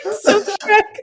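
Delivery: loud, high, laughing through words; cracking up while talking. Real, not acted. Laughing at friend's impression.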